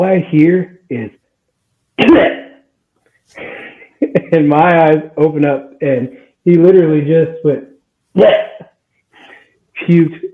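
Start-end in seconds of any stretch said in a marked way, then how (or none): 2.0-2.4 short, high, loud retching splat; puking noise. Retold or imitated. Storyteller imitating a sleeper throwing up in bed, mock puking noise. Acts out vomiting sound he heard. 8.1-8.6 quick high retch, imitated; puking noise. Showing how the boyfriend puked, mock retching noise. He literally just went, puking.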